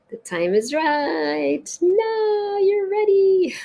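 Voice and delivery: slow lifted oracle voice; drawn-out high reading. Shown as a demonstration. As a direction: stretch each word up high like a revelation. Rereads card for emphasis.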